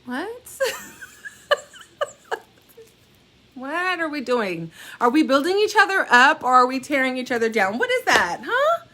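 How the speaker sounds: high, loud scolding mom voice; hammed-up parent lecture, rising to a squeak; mock mom lecture. Mom mock-scolding her son about hackers, high and loud.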